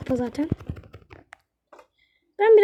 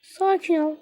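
ordinary talk, nothing marked

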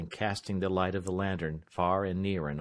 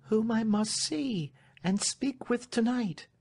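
high, pleading voice; Marian, agitated young lady; 14 semitones higher than usual. Acted. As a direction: appeal anxiously to a trusted servant, high-pitched.

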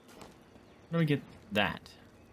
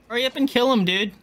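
urgent yell; 11 semitones higher, 5 dB louder than usual. Real, not acted. Urging an ally.

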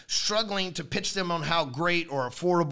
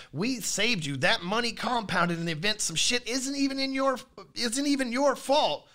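rising sales pitch; 6 semitones higher than usual. Acted.

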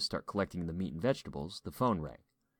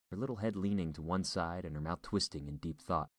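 usual speaking voice

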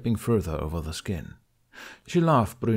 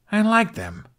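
snappy higher retort; flared; 12 semitones higher, 5 dB louder than usual. A narrator's reading. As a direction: bristle defensively, a touch louder. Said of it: "the human flared".

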